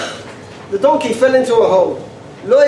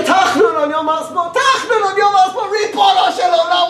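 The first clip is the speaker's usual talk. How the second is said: high mock-outraged cry; 10 semitones higher than usual. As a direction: exclaim in disbelief, high. Outraged at the shul.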